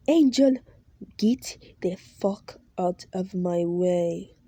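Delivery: loud name, then slow menacing warning; threatening boss. Acted. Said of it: "he says".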